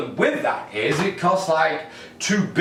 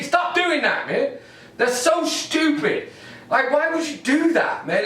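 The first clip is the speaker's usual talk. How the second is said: high, incredulous telling-off; exasperated scolding; 10 semitones higher than usual. Acted. Comedian scolding weathermen, high and fed up. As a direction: scold them, pitched way up.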